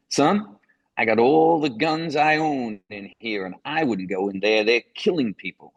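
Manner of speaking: imitating a Southern cop, high and emphatic; mock Southern lawman's warning; Southern policeman. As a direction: play a local cop warning off an outsider. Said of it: "policeman, um, had this kind of southern slouch hat on".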